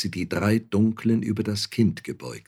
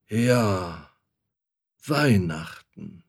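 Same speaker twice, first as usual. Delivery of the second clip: grumbled; much slower than usual. A narrator's reading. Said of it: "brummte er".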